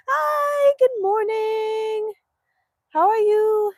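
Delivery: high, drawn-out singsong hello; gushing greeting. Real, not acted. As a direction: greet arriving friends with a high, stretched lilt. Greeting viewers joining the livestream.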